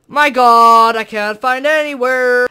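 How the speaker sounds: real frustrated shout; frustrated yell. Real, not acted. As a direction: vent at the empty cave at full volume. Can't find coal.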